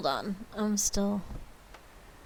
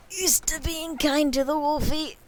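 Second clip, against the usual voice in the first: raised, louder playful voice; higher put-on voice; 8 semitones higher, 6 dB louder than usual. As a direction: pitch it up in a silly voice. Repeats the phrase in a voice.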